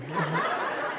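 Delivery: soft chuckle; breathy laugh after the joke; quiet laugh. Real, not acted.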